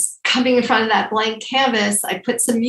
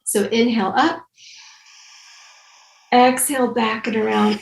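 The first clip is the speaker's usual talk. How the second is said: guided breathing instructions. Acted. Leads shoulder breathing exercise.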